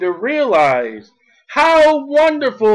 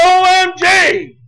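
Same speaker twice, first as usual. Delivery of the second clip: high, stretched mock exclamation; mock cry-out; 7 semitones higher, 4 dB louder, slower than usual. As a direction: cry out the letters slowly, mocking the phrase. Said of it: "they're not going to cry".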